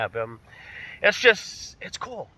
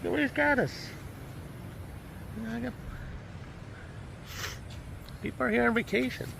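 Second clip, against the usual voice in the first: high, strained, broken by long pauses; emotional, holding it back; 6 semitones higher, 6 dB quieter than usual. Real, not acted. Vlogger looking for his monkeys, real choked-up emotion. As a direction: struggle to get the words out through emotion. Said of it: "Excuse me getting emotional".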